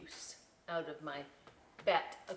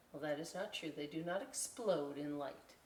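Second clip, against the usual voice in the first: plain reading voice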